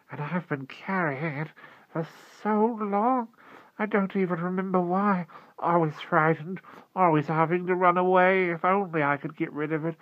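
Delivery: put-on high, broken lament of a small sad child; plaintive. A narrator's reading. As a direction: wail wearily, sinking toward dread at the end. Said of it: "he said plaintively".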